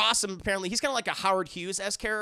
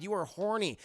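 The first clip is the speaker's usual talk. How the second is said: ordinary talk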